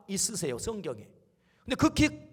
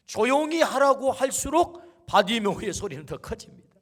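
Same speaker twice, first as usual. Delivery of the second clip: usual speaking voice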